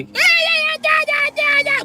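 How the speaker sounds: loud, unintelligible mock child's squeal; high-pitched. Hurt daughter telling on somebody, mock high-pitched screech. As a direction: screech like an upset little girl tattling. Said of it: "Her voice is too high pitched".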